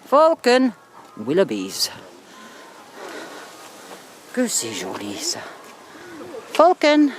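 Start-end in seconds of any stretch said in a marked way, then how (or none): 0.0-0.7 loud, high call across the beach; calling the dog. Real, not acted. 6.5-7.1 loud, high call across the beach; calling the dog. Real, not acted.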